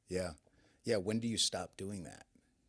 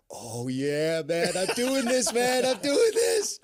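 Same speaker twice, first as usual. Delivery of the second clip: higher, louder and slow, psyching himself up; pumped self-talk; 11 dB louder, slower than usual. Retold or imitated. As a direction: hype yourself up, drawing it out loud and high. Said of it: "And I'm like".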